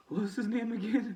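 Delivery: high puzzled question; searching for a name. Real, not acted. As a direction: reach for the name, half laughing. Struggling to recall name.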